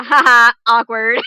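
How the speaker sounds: loud mock awkward laugh; hammed-up awkward "ha ha". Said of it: "Just kinda like a".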